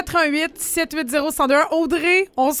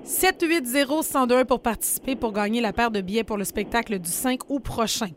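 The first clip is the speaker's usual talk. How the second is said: usual speaking voice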